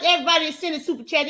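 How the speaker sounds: ordinary talk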